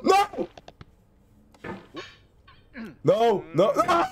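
high cries of despair; despairing cry. Real, not acted. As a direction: wail no repeatedly. Failing near new part.

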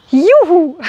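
high, loud cheer; joyful whoop. Real, not acted. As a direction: let out a celebratory yoo-hoo. Cheers after finishing the poem.

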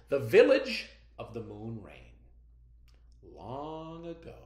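slow, hushed folk tale narration with bright peaks; storytelling read-aloud. Acted. Teacher reading a folk tale to her students, slow and hushed.

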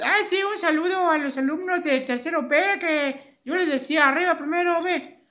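high-pitched puppet character; Beto el muñeco. Acted. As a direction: pipe up as a chirpy little puppet. Voz del muñeco Beto. (voz aguda de muñeco)

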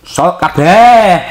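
drawn-out excited call. Real, not acted. Pouring all the sauce in.

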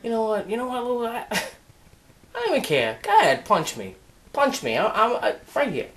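soft, low, defeated grumble; weary, subdued and low; resigned dare. Acted. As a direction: give up quietly and dare them. Richie gives up, invites the punch.

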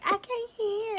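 high-pitched mock whine of a complaint; complaining viewers. Reader mimics viewers who can't hear her, high mock complaint. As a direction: mimic the audience in a high, drawn-out voice. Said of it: "y'all gonna be like".

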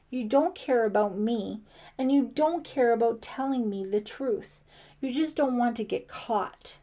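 loud, heated accusation; accusing. A narrator's reading. Ellie unable to stop blaming Brian, accusing. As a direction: drive each charge home, pitch lifting. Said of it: "she accused".

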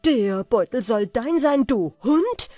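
loud, indignant outburst of staged amazement; feigned astonishment. A narrator's reading. Vizier claims the merchant's purse as his own, feigning shock. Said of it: "rief mit verstelltem Erstaunen".

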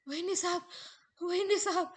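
high, loud calling from the door; urgent shouted call. Acted. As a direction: call out in alarm. नोकर दारातून हाक मारतो. (दारातून घाबरून मोठ्याने हाक)

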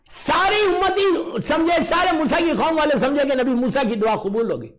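high shouted narration; shouting preacher narration; loud exclamation. Acted. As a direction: cry it out to the hall.

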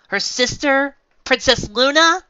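high, loud, rising disbelief; incredulous question. Acted. Twilight corrects the mayor on the successor, incredulous. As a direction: blurt out the obvious answer.

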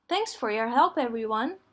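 usual speaking voice

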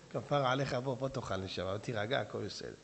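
soothing wife. Acted. Wife soothing her husband.

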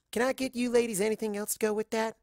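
a friendly waitress taking orders. A narrator's reading. Waitress asking the mares, lightly voiced and brisk.

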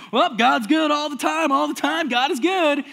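high, loud, sing-song mock piety; hammed-up churchy positivity, voice pushed well up; mock pious cheer. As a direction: parody a forced smile through fear. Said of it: "scared of his life going".

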